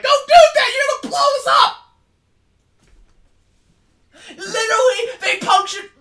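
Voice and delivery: high, shrill panicked robot yelling; panicked droid. Acted. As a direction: shriek warnings like a frantic droid. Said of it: "The droid behind you is like".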